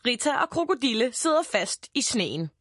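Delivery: plain reading voice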